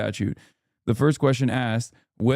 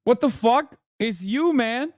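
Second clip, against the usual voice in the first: much higher, disbelieving outburst; high-pitched what-the-heck; exasperated disbelief; 11 semitones higher, slower than usual. Real, not acted. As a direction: throw up your hands in disbelief. Exasperated at the newspaper.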